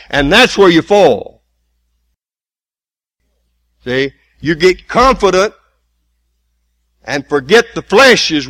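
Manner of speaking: loud, slow, hammering repetition; preacher drilling that the flesh is weak; preaching at full force. Acted. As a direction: pound the point home.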